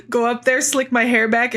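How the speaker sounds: ordinary talk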